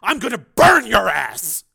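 slow, loud, high fury; furious shouted threat; through gritted teeth. Acted. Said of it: "he said through gritted teeth".

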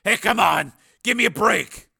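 loud, high-pitched objection; indignant protest. Acted. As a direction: push back loudly at the insult. Steele protests at sergeant's insults.

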